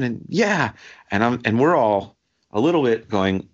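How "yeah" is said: a high, falling yeah from the crowd; imitating crowd yeah. Worshippers egging on the pastor, a high, fervent yeah. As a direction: answer the preacher like an eager congregant. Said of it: "people were behind us going".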